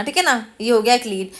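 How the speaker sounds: ordinary talk, nothing marked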